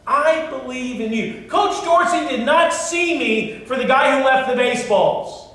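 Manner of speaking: high, loud, ringing pulpit voice; full-force preaching. Acted. Pastor rousing the congregation, preaching at full force.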